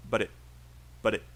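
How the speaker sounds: "but it" said small, both words unstressed. Shown as a demonstration. Teacher shows students the small words, "but it" unstressed. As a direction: run "but it" together as two light, unstressed words. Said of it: "it's really small. Both are unstressed".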